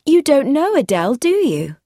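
high, loud social flutter; crying out. A narrator's reading. Woman introducing her brother-in-law, crying out. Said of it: "cried Jennifer".

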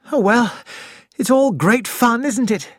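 forced cheer hiding nerves; Nick. Acted.

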